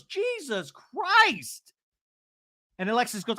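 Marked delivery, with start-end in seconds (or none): 0.0-1.7 high, long-stretched exclamation; hammed-up exasperated cry; imitated exasperation. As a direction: wail it slowly in mock disbelief. Acts out Emily's exasperated outburst.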